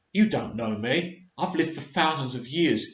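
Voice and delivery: lightly voiced Doctor pushing a friend away; the Doctor, cold. Retelling the Doctor snapping at Ryan.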